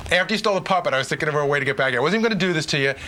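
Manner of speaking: usual speaking voice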